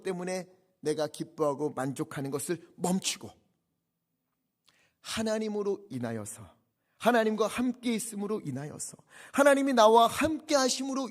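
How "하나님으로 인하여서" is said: ordinary talk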